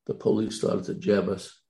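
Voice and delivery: normal talking voice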